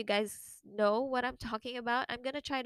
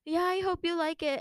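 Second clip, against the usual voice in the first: a hint of a sweet, higher giver's voice; gift giver; 7 semitones higher, 4 dB louder than usual. The giver hoping she likes the present, lightly voiced and higher. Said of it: "And she was like".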